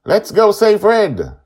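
high, loud, slow rallying call; rally the crew to the rescue; determined girl. A narrator's reading. Joy rallies the crew to save Red, high and loud.

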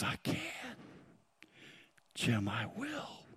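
soft whisper, drawn out at the end. Acted. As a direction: whisper a quiet promise to each person by name. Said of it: "God whispers".

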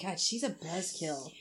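muttered eye-roll. Retold or imitated. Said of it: "She's like".

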